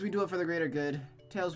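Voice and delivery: ordinary delivery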